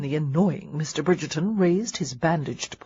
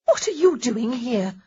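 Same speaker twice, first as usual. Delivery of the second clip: startled; 6 semitones higher, 3 dB louder than usual. Acted. Startled Honoria confronts Marcus.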